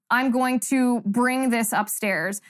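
"I'm going to bring this upstairs" said slowly as the wrong usage; slow wrong example. Shown as a demonstration. Host demos the grammar error for viewers. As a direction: spell out the mistake slowly. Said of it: "by saying".

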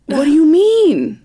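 high, loud, drawn-out bewilderment; incredulous question. Real, not acted. Storyteller answering co-host's protest, incredulous. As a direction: push the question up, baffled and slow.

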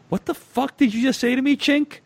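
high, mock menacing thug voice; mock thug threat. The angry stranger threatening the letter writer, high mock menace. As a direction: play the big guy looming over the seat. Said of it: "menacingly said".

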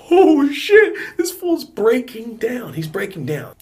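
very high, loud mock disbelief; astonished reaction. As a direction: draw it out high, reliving the shock. Retelling stunned reaction to meltdown.

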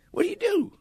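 very high imitated sheriff; mock sheriff's question; small-town sheriff. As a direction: ask it pitched right up, like a curious lawman. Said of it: "You know, he said".

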